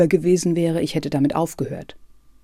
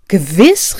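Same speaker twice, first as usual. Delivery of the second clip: a louder, higher cry of assent; eager exclamation; 4 semitones higher, 7 dB louder than usual. Acted. Kafka eagerly calls out that he knows the book. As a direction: jump in with enthusiasm. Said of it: "rief Kafka".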